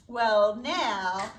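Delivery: plain reading voice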